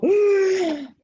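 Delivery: sharp high gasp coming up from the water; gasp for air. Retold or imitated. Surfer pulled from the water, retold gasp. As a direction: suck in air like you just surfaced. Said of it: "all of a sudden I go".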